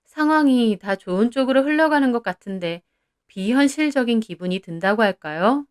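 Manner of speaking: ordinary delivery, nothing marked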